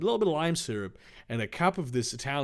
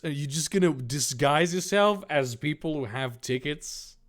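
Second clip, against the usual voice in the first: ordinary delivery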